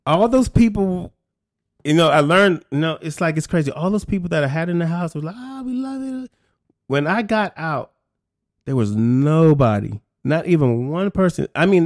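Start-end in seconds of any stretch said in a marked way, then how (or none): 5.4-6.3 higher, airy lazy cheer; a hint of freeloaders enjoying themselves; mock partying friends. Hangers-on in his house, a high careless mock cheer.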